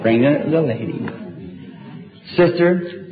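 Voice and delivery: ordinary talk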